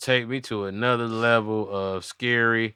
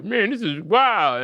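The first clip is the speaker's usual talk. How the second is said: slow, high, drawn-out amazement; stunned disbelief; 9 semitones higher, slower than usual. Pretend. The guy on the plane taking it all in, slow stunned voice. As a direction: let the wildness sink in.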